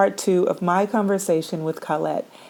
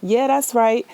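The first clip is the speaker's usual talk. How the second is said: higher, drawn-in hum of agreement, then affirming; agreeing listener; 8 semitones higher, slower than usual. Acted. Host voicing listeners agreeing along with the podcast, affirming. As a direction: chime in like a girlfriend nodding along. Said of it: "you would feel like you could".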